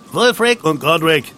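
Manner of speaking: high, loud roll-call answer. A narrator's reading. Twins answer the roll call, calling out. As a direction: shout your names back. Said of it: "riefen seine Begleiter".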